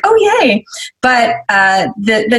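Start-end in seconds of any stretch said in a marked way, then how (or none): 0.0-0.6 high-pitched mock-delighted cheer; excited. Reacting to email from someone liked, playfully excited. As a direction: squeal it lightly, playing up the delight. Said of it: "I'm always so excited".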